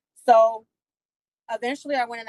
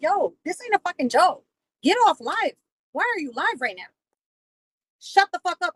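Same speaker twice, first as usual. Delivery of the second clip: higher-pitched, swooping indignant protest; retold exasperation; 7 semitones higher than usual. Retelling her telling him to get off live, acted exasperation. Retold angry quarrel about his livestream.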